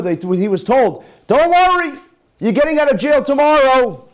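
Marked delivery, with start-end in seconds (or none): 1.3-4.1 announcing guard. Retold or imitated. Guard's promise to the prisoner.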